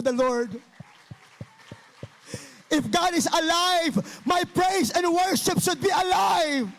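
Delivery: fiery revival preaching; preaching at full force. Acted. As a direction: rouse the congregation to worship. Preacher builds to shouted praise.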